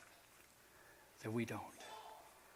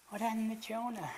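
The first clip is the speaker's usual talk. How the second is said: puppet voice; 10 semitones higher than usual. Acted. Puppet asks about Jonah.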